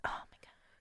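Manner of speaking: breathless whispered oh my God; swooning whisper. Real, not acted. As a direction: whisper it, overcome. Gushing over his eyes.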